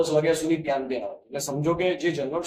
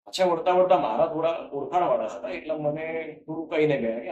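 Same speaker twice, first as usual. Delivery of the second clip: ordinary delivery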